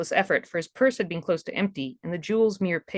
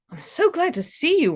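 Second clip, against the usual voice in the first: put-on raised, eager young man; Ralph's voice; 10 semitones higher, 4 dB louder than usual. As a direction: glad and relieved, voiced higher. Ralph greets his returning lover.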